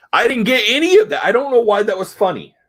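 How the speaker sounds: high, loud baffled complaint; exasperated bafflement. Real, not acted. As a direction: throw up a high-pitched protest of not getting it. Baffled complaint about the comic's jokes.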